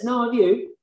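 high, cheeky throwback question; cheeky comeback. Retold or imitated. Drunk man answering the cop, high and cheeky. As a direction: toss the question right back at him.

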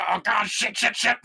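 hammed-up swearing as the cops close in; mock panic. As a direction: pretend cops realizing they're caught. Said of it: "They're like".

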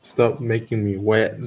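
ordinary delivery

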